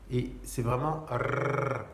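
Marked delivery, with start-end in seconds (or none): 1.1-1.8 long trilled "rrr"; tongue-rolled R. Shown as a demonstration. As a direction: roll "rrr" on the tip of the tongue. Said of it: "c'est la langue qui fait ça". (« rrr » roulé avec la langue)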